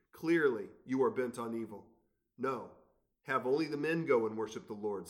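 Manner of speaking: lifted, measured Bible reading; scripture reading. Acted. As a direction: read God's words to Pharaoh with weight.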